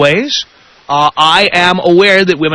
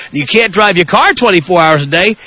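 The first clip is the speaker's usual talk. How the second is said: normal talking voice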